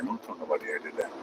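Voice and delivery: a quiet conspiratorial confession; mock-secret aside. Trucker to his viewers, sharing a joking secret, hushed.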